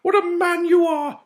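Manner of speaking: high, loud fawning cry; creepy knight shout. A narrator's reading. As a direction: gush over him shamelessly. Creepy knight flattering Mowbray.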